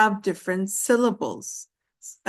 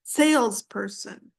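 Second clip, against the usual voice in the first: "salesperson" with the weight on SALES; stress on first syllable. Shown as a demonstration. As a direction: lean on SALES, lighter person in "salesperson". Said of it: "not salesperson".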